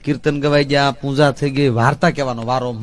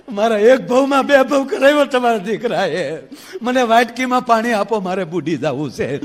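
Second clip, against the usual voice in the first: wailing wife; 6 semitones higher, 4 dB louder than usual. Acted. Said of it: "એ રડતી રડતી".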